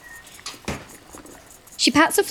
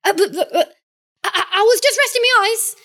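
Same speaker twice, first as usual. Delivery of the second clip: put-on startled boy voice; 9 semitones higher, faster than usual. Sleepy boy caught dozing.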